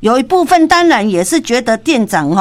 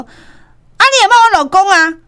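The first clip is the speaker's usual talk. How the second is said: indignant wife; 9 semitones higher, 4 dB louder, slower than usual. Retold or imitated. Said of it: "对咱讲话讲".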